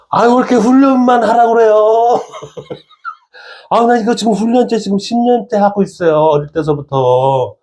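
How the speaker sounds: high-pitched, hammed-up whining; whiny protest. Young footballer protesting to God about endless training, hammed-up whine. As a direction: complain like an exasperated young trainee.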